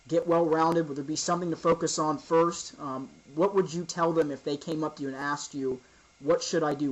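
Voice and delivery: usual speaking voice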